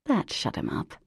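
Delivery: ordinary delivery, nothing marked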